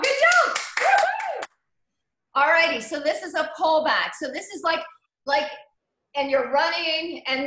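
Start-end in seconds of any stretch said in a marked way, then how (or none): 0.0-1.5 much higher, louder cheer into a whoop; excited whoop. Real, not acted. Instructor whooping to cheer her spin class, excited. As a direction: cheer them on with a big high woo-hoo.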